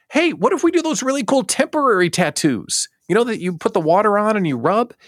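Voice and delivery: very high, hammed-up brainstorm voice; eager pitch. Staffer suggesting temporary tattoos to the team, eager. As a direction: float it high like an excitable staffer. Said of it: "let's go into the decision-making room".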